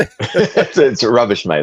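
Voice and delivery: laughing into a put-on British accent. Guest riffing on friend's banter, laughing British accent. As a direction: joke British put-down, starting high with laughter. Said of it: "I do a British accent".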